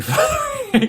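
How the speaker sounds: laughing. Real, not acted. Answering for Hank, laughing.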